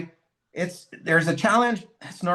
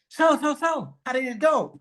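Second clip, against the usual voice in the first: higher, eager so so so; eager Claudia; 8 semitones higher than usual. Retold or imitated. Claudia phoning her husband for news, eager and higher. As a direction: press him eagerly for news. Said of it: "And Claudia is like".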